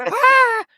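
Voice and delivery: a high, shrill-pitched mock shriek of fright; mock scream. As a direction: shriek like the startled innkeeper. Innkeeper screams at the sight.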